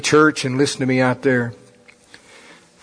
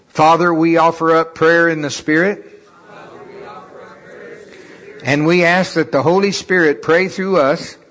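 steady, solemn praying voice; prayer; 3 semitones higher than usual. Acted. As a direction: pray it out earnestly. Leads a prayer.

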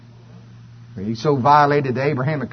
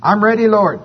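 higher, louder, eager churchgoer volunteering; eager believer; 7 semitones higher, 5 dB louder than usual. Pretend. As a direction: chirp it like a keen believer ready to serve.

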